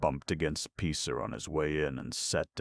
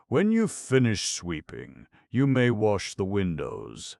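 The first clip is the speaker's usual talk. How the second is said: pompous order; 7 semitones higher, 9 dB louder than usual. A narrator's reading. Chief scrapper gives orders.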